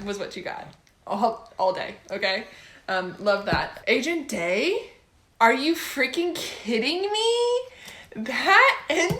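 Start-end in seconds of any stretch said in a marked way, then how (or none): 5.4-9.2 higher, louder and slow disbelief; real shock at a twist ending, slowed and pitched up; stunned outrage. Real, not acted. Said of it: "outraged at the ending".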